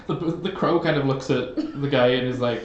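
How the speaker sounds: usual speaking voice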